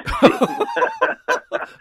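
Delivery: real loud, high-pitched laugh; big burst of laughter. Real, not acted. Hosts hearing about recycled sandwich wrap, big laughter.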